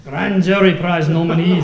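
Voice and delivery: loud, laughing Scottish accent attempt; a hammy stab at a Scottish accent. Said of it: "Use your best Scottish accent".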